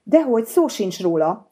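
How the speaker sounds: put-on lightly flustered boy's reply. A narrator's reading. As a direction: answer flustered, lightly voiced. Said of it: "felelte zavarodottan".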